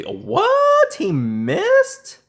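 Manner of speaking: a high yelp of disbelief, then loud excitement; shocked what. Real, not acted. Gamer stunned when the rival misses a kill, shocked yelp. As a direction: squeal it like you can't believe your luck.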